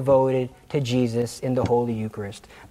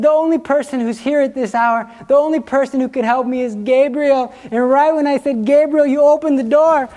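much higher, breathless excited voice; excited woman impression; 9 semitones higher, 4 dB louder than usual. Teller voicing Anne still shaken after the bookcase fell. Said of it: "impersonation of her".

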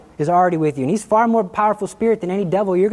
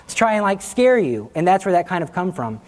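ordinary delivery

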